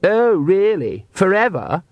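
high, drawn-out scornful question; sarcastic challenge. Acted. Vampire daughter challenging her father as head of the clan, scornful.

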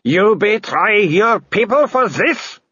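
very slow high slurred sneer; slurring Baroness. A narrator's reading. Baroness sneering at Carrot, slow and slurred. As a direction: drag the words, half wolf. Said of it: "her voice slurring".

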